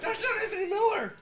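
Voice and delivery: high, loud laughing outburst; excited shout. Real, not acted. Reacting to football on TV.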